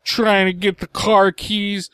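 high, slow, teary retelling; crying voice. Retold or imitated. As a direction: imitate a dad crying on the phone. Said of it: "I'm trying to do crying voice".

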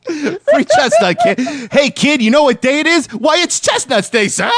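high, hammed-up glee; mock festive street scene in a high voice; old-movie holiday caller. Acts out invented Chestnut Day street scene.

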